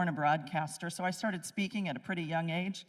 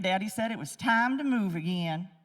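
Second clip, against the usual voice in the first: ordinary talk, nothing marked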